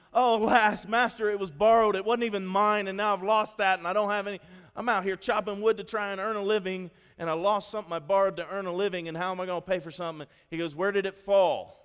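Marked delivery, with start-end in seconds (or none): 0.1-10.3 loud, high, acted distressed plea; crying out. Prophet's son has lost a borrowed axe head, loud frantic cry. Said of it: "he cries out to the prophet". 10.8-11.8 loud, high, drawn-out question; calling out. Acted. Prophet asks the man about the lost axe head, loud slow call.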